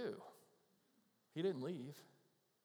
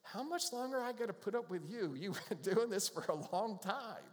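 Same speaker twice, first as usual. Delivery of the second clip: exasperated Jesus; 8 semitones higher than usual. Retold or imitated. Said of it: "Jesus ever looks at me and goes".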